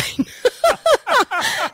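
high-pitched burst of laughter; real laugh. Real, not acted. Laughing over how the name was got, high real laugh. Laughs at joke about names.

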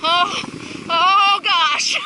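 high, loud gasping cries on the way down; real excitement mid-ride; thrilled yelp. Real, not acted. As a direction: yelp as the sled takes off. Riding the sled downhill.

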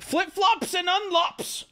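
high, stretched-out hype call; announcing shout-out. Acted. Streamer hailing a donor mid-post, high drawn-out shout-out. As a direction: sing out the username high and slow.

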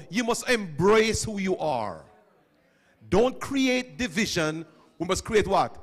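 ordinary talk, nothing marked

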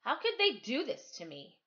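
usual speaking voice

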